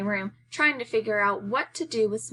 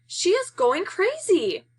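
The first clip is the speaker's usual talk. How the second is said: much higher, louder, slow panicked cry; alarmed cry; 10 semitones higher, 4 dB louder, slower than usual. Acted. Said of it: "Kermit says in dismay".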